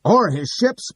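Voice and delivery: loud, high bellow; bawling. Acted. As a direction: bawl it across the courtroom. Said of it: "bawls Clitheroe".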